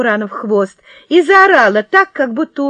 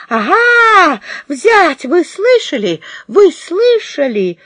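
indignant; 8 semitones higher, 3 dB louder, slower than usual. Acted. Woman indignant to crowd.

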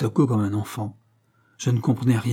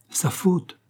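higher-pitched, astonished echo; astonished with regret; 8 semitones higher than usual. A narrator's reading. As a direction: echo it in rueful disbelief. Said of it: "avec un étonnement mêlé de regret". (étonnement mêlé de regret)

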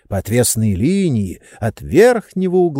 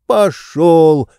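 louder, higher shout; 6 semitones higher, 6 dB louder than usual. A narrator's reading. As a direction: bellow it out. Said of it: "закричал благим матом".

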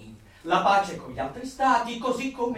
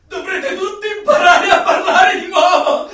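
fanatical glee; 10 semitones higher, 13 dB louder than usual. Acted. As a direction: crow it like a zealot with a triumphant laugh. Fanatic proclaiming the new language.

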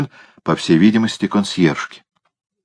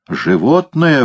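indignant; 8 semitones higher, slower than usual. Acted. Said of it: "возмутился кот".

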